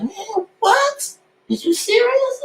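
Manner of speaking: high, squeaky disbelief; disbelieving. Retold or imitated. Rapper to podcast hosts, retelling the offers, high squeak of disbelief. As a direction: shriek of mock amazement.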